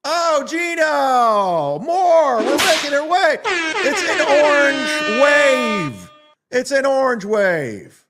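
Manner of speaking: high, loud, drawn-out cheering; hyped celebration. Acted. Streamer cheering the donation wave, high and loud. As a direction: cheer like a ring announcer.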